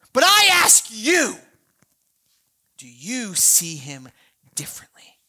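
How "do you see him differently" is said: a preacher's searching whisper; dramatic whisper. Real, not acted. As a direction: lean in and whisper the question. Sermon question to the congregation.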